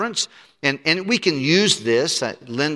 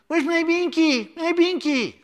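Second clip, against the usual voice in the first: put-on much higher, slow whiny little kid; toddler voice. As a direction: whine like a toddler missing a pacifier. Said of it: "they say dumb things".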